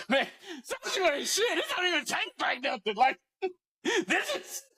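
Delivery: put-on high, laughing drunk yell; drunken yelling. Friend on the third soju bowl yelling that it won't get him drunk. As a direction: holler it like the booze finally hit. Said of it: "why are you yelling".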